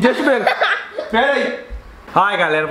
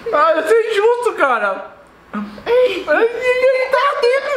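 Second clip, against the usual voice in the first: high, strained laughing whine; 12 semitones higher, slower than usual. Real, not acted. Laughing complaint about burning candy.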